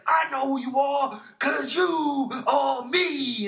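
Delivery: slow, drawn-out mock shout in a put-on voice; hammed-up, dragged-out pirate line; Yondu impression. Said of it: "But that, that one seems like".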